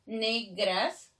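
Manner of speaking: "negras" split in two, rising at the end; syllable by syllable. Shown as a demonstration. Teacher counting syllables, "negras" separated slowly. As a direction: sound out "negras" as ne, gras. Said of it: "Si yo separo esto normal".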